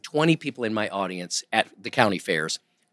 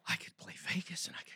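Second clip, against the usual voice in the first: hushed whisper, breathless with ambition; a young man whispering his big dream to himself; whispered daydream; 8 semitones higher, 21 dB quieter, faster than usual. Retold or imitated. Dreaming of a Vegas future.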